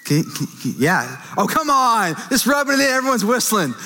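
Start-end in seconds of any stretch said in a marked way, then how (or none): 1.4-3.7 higher, playful complaint at the crowd; laughing off the whistling crowd, mock hurt; mock exasperated protest. Real, not acted. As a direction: pretend to be wounded by their teasing.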